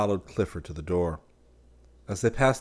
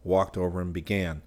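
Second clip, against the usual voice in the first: usual speaking voice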